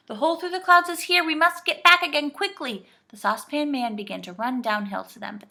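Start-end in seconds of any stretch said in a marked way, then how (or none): 0.1-2.9 high, loud, urgent voice; despairing shout. A narrator's reading. Joe points out the hole, shouting urgently. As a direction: urge them back desperately. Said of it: "shouted Joe in despair".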